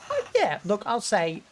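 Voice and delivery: ordinary delivery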